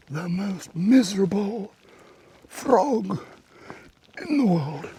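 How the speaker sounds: high, slow, pitying announcement; mock tragic. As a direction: present the saddest frog on earth. Mock-tragic frog introduction.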